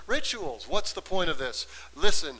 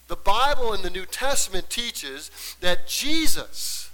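forceful preaching; 4 semitones higher, 3 dB louder than usual. Real, not acted. Preacher declares Christ as sin bearer to congregation, forceful preaching.

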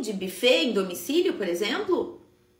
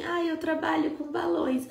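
small unsure voice; 5 semitones higher than usual. Shown as a demonstration. Coach shows students the wrong way, lightly shy and unsure. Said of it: "que você tenha tom de voz de empresária".

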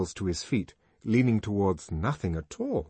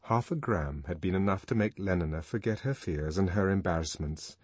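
plain reading voice

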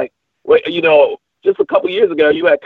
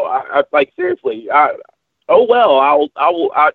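ordinary talk, nothing marked

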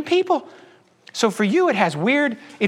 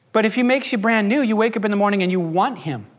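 ordinary talk

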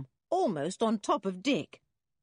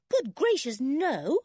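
put-on high, raised girl's protest; horrified little no, pitched up; emphatic denial; 9 semitones higher, 6 dB louder, slower than usual. A narrator's reading. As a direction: reject the idea with high-pitched alarm. Anne recoils at idea of adventure.

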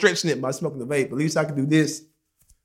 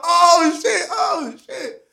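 retold scream; 12 semitones higher, much slower than usual. Man screaming on the phone during the fight, retold. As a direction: squeal it high, as if watching a fight. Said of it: "So I scream on fire".

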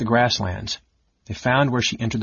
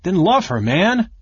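eager plea; 8 semitones higher, 4 dB louder, slower than usual. Acted. Caramon pleading with his brother.

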